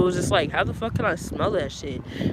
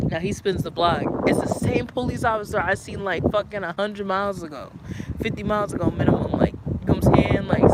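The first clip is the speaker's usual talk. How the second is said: ordinary delivery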